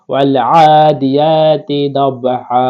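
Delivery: slow, drawn-out Quran recitation; measured Quranic recitation. Shown as a demonstration. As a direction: chant it slowly like tajweed practice.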